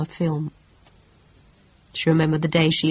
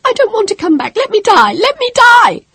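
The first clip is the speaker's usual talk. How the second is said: high, loud pleading scream; begging to die, screaming; desperate screaming; 10 dB louder than usual. Acted. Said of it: "she screamed".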